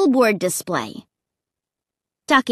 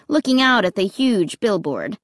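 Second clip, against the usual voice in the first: ordinary delivery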